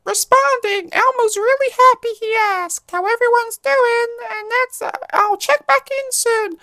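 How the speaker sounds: chirpy high Elmo voice checking in; bright high-pitched Muppet voice; Elmo impression. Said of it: "That's not a good impression".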